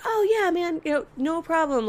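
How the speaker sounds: reassuring. Retold or imitated. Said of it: "And I was like".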